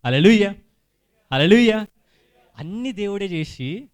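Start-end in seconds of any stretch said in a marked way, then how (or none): 0.1-1.9 loud preacher's call to the congregation; shouted hallelujah. Real, not acted. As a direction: shout it out in praise.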